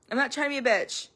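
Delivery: normal talking voice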